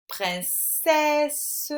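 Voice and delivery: "Princesse" with a high, held è in the last syllable; emphasised è sound. Shown as a demonstration. Teacher corrects the exercise, è in "Princesse" emphasised. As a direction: lift and stretch "-cesse" in "Princesse". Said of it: "on entend bien le est".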